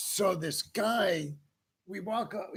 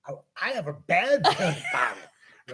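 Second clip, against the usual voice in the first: louder, laughing through the line; half-laughed confession; 8 dB louder than usual. Retold or imitated. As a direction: chuckle while admitting fear. Retold fear, laughs at himself.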